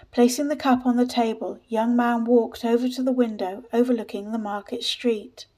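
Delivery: usual speaking voice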